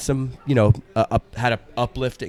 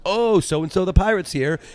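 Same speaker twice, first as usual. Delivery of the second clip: announcer; 8 semitones higher than usual. Retold or imitated. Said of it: "the karaoke guy's like".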